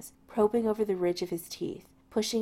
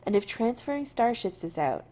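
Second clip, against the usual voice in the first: usual speaking voice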